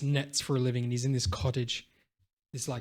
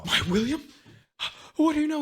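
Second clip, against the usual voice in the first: put-on high, rising, louder old man's plea; blind father, urgent; 5 dB louder, faster than usual. Father learns news of his son, high and urgent. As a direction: push the old father's voice up in alarm.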